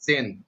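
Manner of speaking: जेन as one syllable ending in n; correct pronunciation. Shown as a demonstration. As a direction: say जेन with no vowel after the n. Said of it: "लेकिन उसको सही उच्चारण होता है".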